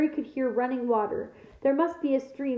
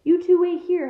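louder, higher order; shout; 6 semitones higher, 6 dB louder than usual. A narrator's reading. Hagrid ordering the children to wait, shouting. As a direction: shout the order to stay put. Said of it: "Hagrid shouted".

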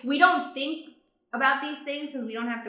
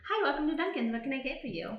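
bright drive-thru worker greeting; drive thru speaker; 4 semitones higher, 6 dB quieter, faster than usual. Pretend. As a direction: play the cheerful order taker. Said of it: "Here, we'll do it".

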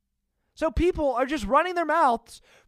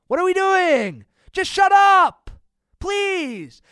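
loud, higher fed-up yell; exasperated shout; 5 semitones higher, 6 dB louder, slower than usual. Real, not acted. Vlogger telling whining fans to shut up, exasperated. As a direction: snap it out, done with them.

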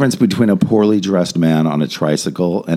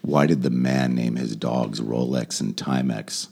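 low deadpan; 9 semitones lower, 8 dB quieter, slower than usual. Acted. Telling dad joke low.

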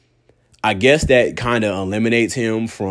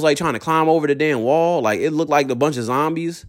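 ordinary talk

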